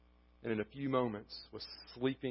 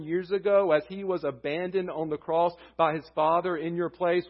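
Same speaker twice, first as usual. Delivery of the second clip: loud, raised preaching voice; rising pulpit fervor; fervent preaching; 5 semitones higher, 8 dB louder than usual. Acted. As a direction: preach it out with full force. Preaching at full volume.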